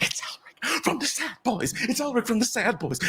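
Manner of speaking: high-pitched starstruck chatter; high, hushed-squeal gossip; excited fans. Acted. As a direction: pass the news on like giddy fans. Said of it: "talking with each other really excitedly".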